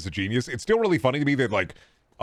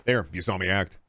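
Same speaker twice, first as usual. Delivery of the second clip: ordinary delivery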